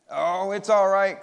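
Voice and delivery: loud, drawn-out reassuring call; crying out. A narrator's reading. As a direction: brush off the worry, calling back slowly. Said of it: "cried Larry".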